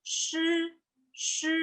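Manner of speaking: Sh soft, tongue bent to the palate; curled-tongue Sh. Shown as a demonstration. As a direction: hold a quiet retroflex Sh. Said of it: "lidah tetap ditekuk".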